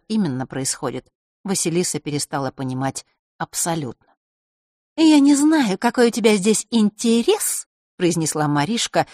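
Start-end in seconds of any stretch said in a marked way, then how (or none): 5.0-7.7 high, mocking girl's voice. A girl taunting the boy, mocking. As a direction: sneer it down at him. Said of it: "насмешливо покосившись".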